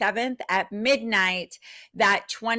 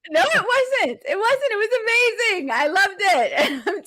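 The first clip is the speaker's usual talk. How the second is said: excited reassurance; 10 semitones higher than usual. Real, not acted. Hyped reassurance to co-host.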